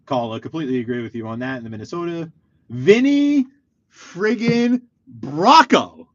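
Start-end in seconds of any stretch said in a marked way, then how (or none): 2.7-6.1 loud, high, drawn-out name announcement; excited hailing. Real, not acted. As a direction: belt out the name like a star just walked in. Hailing a celebrity entering the chat.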